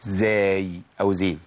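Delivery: z voiced instead of s; voiced z demo. Shown as a demonstration. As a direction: buzz the z in زين. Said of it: "لو ما مشيتش النفس في السين هتبقى".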